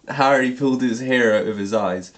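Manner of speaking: ordinary delivery, nothing marked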